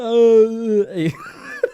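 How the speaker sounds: high shouted seal squeal dissolving in laughter; failed seal call. Retold or imitated. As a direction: try the seal and crack up laughing. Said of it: "that was not it".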